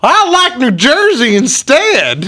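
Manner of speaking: high, loud, slow mock Texan dimwit; mock goober. Mocking a Texan who prefers New Jersey, loud goober voice. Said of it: "this fucking gooba goes".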